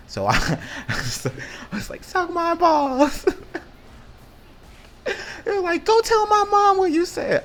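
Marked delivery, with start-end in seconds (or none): 2.0-3.3 taunt. Retold or imitated. Taunting kid on playground. 5.9-7.4 put-on much higher, louder girl voice daring him; mocking girl threat.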